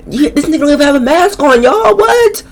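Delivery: very high, squeaky disbelief; high-pitched "can you believe this"; incredulous. Real, not acted. Amazed at missing mask.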